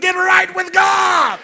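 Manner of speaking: slow, high, shouted refrain; preacher peaking on the final call; climactic shout. Acted.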